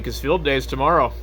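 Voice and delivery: usual speaking voice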